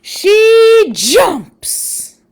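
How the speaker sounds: lively read-aloud storytelling voice; story narration. Acted. Parent reading a spider book to listeners, storytelling voice.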